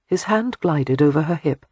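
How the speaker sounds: usual speaking voice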